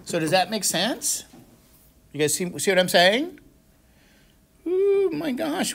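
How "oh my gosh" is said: surprised exclamation. Real, not acted. Teacher exclaims at a class slip.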